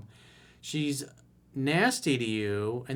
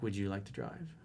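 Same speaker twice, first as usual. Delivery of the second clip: sarcastic offer; 5 semitones lower, 10 dB quieter than usual. Shown as a demonstration. Girlfriend critiques his driving.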